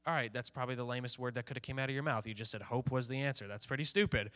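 low, soft, unimpressed aside; mock dismissive listener. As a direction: play a skeptic brushing it off. Voicing a skeptical listener.

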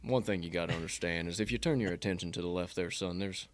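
low, quiet coach impression; folksy recruiting pitch, soft and deep; Saban. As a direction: talk a recruit round in a low, understated voice. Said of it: "He's spot on".

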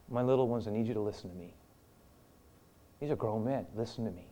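tender and quiet; tender plea. Retold or imitated. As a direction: soothe them as a parent would. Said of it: "A very tender".

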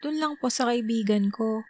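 put-on high, innocent child answer; little girl voice. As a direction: chirp it like a carefree four-year-old. Little sister answering mom.

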